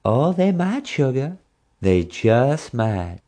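slow, haughty Texas drawl; a grand Texas lady purring; Flora Hancock impression. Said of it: "her Flora Hancock impression again".

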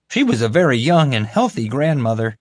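high, insistent guard voice; defensive. Acted. Guard excusing himself to his boss, defensively. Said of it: "Kevin said in his defense".

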